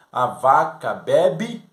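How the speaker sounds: slow, loud question rising on "bebe"; "A vaca bebe?" said slowly and loudly, rising on "bebe"; drawn-out question. Shown as a demonstration. Teacher demonstrating the trick question to the class, slow and loud. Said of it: "A pergunta é".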